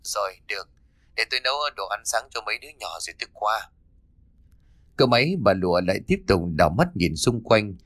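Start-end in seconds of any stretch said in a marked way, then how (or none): 0.0-3.7 soft, distant voice through a phone. Acted. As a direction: sound like the phone line. Friend on the phone.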